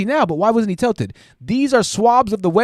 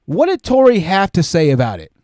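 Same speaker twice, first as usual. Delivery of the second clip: ordinary talk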